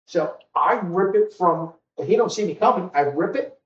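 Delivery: usual speaking voice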